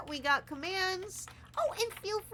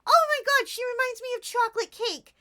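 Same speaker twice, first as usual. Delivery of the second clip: gushing excitement; 9 semitones higher, 8 dB louder, faster than usual. Real, not acted. Gushing over the new animal.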